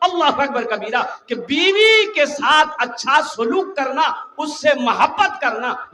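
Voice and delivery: loud, high, slow preaching with laughs; rousing sermon. Acted. Preacher telling the faithful to love their wives, rousing. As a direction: swell it up for the crowd.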